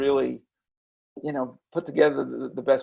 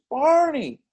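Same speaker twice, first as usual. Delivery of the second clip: imitating a little girl; 12 semitones higher than usual. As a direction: call out like a small girl in a hospital bed. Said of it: "and she goes".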